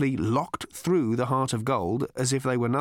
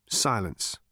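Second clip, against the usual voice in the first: ordinary delivery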